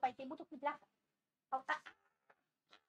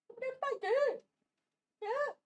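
protesting the bite; 8 semitones higher, 6 dB louder than usual. Real, not acted. Dog biting, owner protests.

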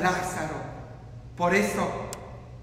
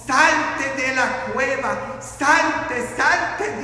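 preacher's shout; 2 semitones higher, 4 dB louder, much slower than usual. Retold or imitated. Preacher voices Jesus ordering people out of the cave, rising shout. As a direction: call them out with mounting urgency. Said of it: "Jesús está gritando a nosotros".

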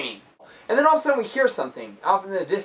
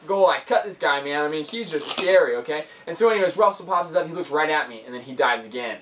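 normal talking voice

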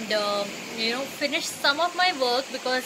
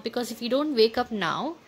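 usual speaking voice

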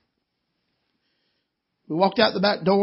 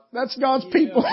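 laughing, near tears; 3 semitones higher than usual. Real, not acted. Overcome telling of gift.

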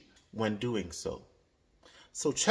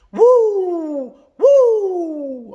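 very high, loud "Woo!" cheer; basketball fan whoop; 12 dB louder than usual. Shown as a demonstration. Fan cheering a score, high loud whoop. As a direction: cheer like your team just scored. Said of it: "make a sound like that".

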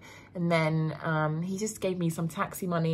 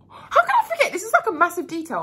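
laughing in disbelief, much higher and louder; 13 semitones higher, 7 dB louder than usual. Real, not acted. Telling her viewers she almost forgot, laughing. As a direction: squeal it out through a laugh.

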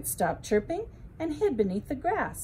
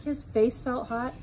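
plain reading voice

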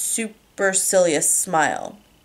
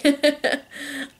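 real laugh; 8 semitones higher than usual. Real, not acted. Narrator laughs at own flub.

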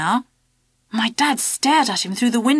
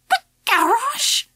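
high slow squeak of shock; 13 semitones higher, slower than usual. A narrator's reading. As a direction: voice jumps up high, slowly. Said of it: "squeaked my dad".

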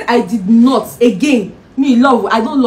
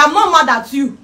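ordinary talk, nothing marked

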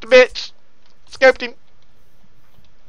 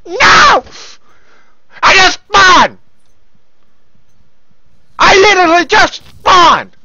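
high, exasperated protest shout; outraged yelling; 5 semitones higher, slower than usual. Real, not acted. Gamer killed straight after spawning, outraged yelling. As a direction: yell in disbelief at dying.

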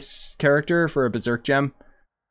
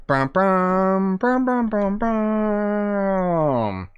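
hummed fanfare; 7 semitones higher, much slower than usual. Pretend. Fanfare for the finally crafted item.

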